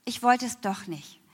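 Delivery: ordinary talk, nothing marked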